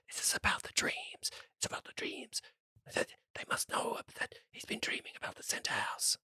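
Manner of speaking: hushed, unvoiced whisper. Guards whisper to each other about dreams, whispering. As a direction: whisper it furtively to a comrade. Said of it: "they're kinda whispering to each other".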